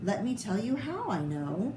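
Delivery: plain reading voice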